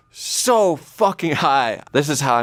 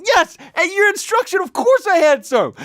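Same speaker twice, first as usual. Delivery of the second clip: much higher, strained panicked squeal; panicked shriek. Retold or imitated. Answering the roommate about the cake, high panicked shriek. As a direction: squeak it out in full mock panic.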